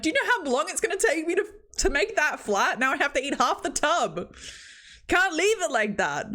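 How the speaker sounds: high-pitched comic rant; hammed-up indignant complaint; mock exasperation. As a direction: scold in playful outrage. Mock rant at ice cream scoopers.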